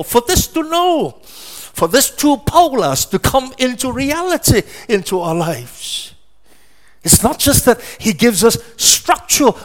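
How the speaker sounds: high, loud, ringing preacher's cry; full-force preaching. Acted. Preacher rousing the congregation, high and loud preaching. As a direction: lift the congregation with each phrase.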